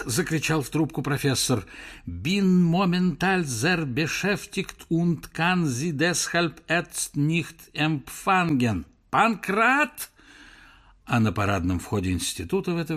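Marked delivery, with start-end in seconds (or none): 2.1-8.8 slow, deliberate German. Acted. Professor refuses German reporter by phone, slow. 9.1-10.1 high loud yell; shout. Acted. Professor on phone yells for Pankrat.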